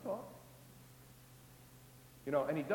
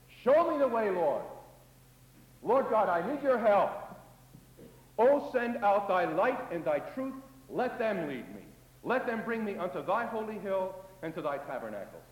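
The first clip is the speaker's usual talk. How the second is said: louder, earnest plea to God; prayer for light; 3 semitones higher, 4 dB louder than usual. Acted. Preacher praying the psalm aloud, loud earnest plea. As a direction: cry out to God for guidance.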